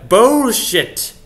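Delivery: slow, loud, drawn-out exclamation; emphatic outburst. Acted. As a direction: belt it out, stretching the word. Triumphant punchline to word search.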